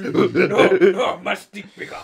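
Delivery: loud, dumb ape-man boast; caveman voice. Retold or imitated. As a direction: grunt it like a proud caveman. Joins ape impression.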